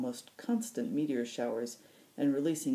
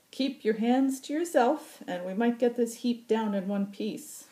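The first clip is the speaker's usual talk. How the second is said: put-on teasing old pilot; 9 semitones higher, 7 dB louder than usual. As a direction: needle him like a cocky old pilot. Occam voiced, teasing Hakon.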